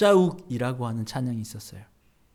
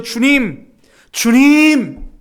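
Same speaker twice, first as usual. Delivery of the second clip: loud, high cry of "주님"; crying out to God; 8 semitones higher, 11 dB louder, much slower than usual. Retold or imitated. Sufferer bowing head crying out to God, loud. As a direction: call out to the Lord from the depths. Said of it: "고개 숙이고 고백하는 거예요".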